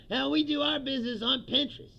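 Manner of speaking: high, loud sarcastic impression of a shrugging official; mock dismissive official. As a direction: ham up the carefree denial, high and loud. Mocking officials' dismissive line.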